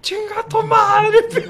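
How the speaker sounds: high, slow laughing yell; imitated furious comeback; mock angry reply. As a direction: fire the insult back slowly. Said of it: "el Piojo voltea y dice".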